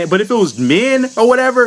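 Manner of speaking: ordinary delivery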